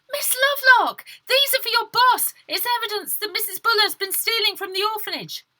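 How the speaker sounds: high, loud breathless cry; high, loud desperate girl; urgent plea. Acted. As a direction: plead with everything you have.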